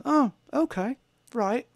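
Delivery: high, bright imitation of a Liverpool accent. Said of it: "In that accent".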